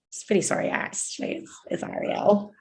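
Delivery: whispered. Retold or imitated. Quietly correcting interviewer.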